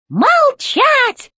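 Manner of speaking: high, shouted robot command; shouted robot order. A narrator's reading. Robot chef repeating his order to Alice, now shouting. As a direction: bellow it the second time. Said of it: "и он повторил".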